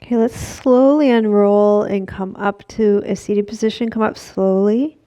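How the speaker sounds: yoga instructor voice. Acted. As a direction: lead the class through the stretch. Cues a forward fold stretch.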